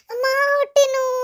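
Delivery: high, tearful wail; acted labour-pain wail; crying in pain. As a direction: cry out to mother in pain. Daughter in labour calls her mother.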